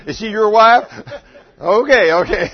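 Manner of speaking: playful jab at a man in the crowd; laughing tease. Real, not acted. Teasing audience member.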